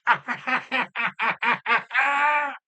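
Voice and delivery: high put-on laugh mimicking his friend; imitated laugh. Man mimicking his friend Jess's laugh, high and giggly. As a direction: do her big squeaky laugh. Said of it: "She goes".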